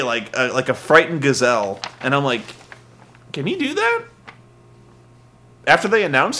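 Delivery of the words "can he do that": very high, incredulous question; mock bewilderment. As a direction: ask in a hammed-up high voice. Acted puzzled reaction to gazelle escape.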